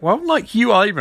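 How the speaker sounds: high, indignant retort; hammed-up squabbling comeback; mock bickering. Ronnie snaps back at Professor Stein, mock indignant. Acted bickering between the two men.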